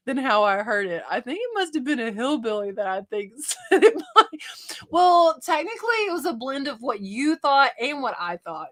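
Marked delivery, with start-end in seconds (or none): none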